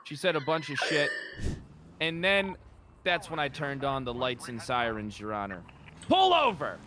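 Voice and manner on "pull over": loud, higher police bark; shouted command. Retold or imitated. Officer recounting ordering the suspect to pull over, shouting. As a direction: order the car over at full voice. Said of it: "I proclaimed at the top of my lungs".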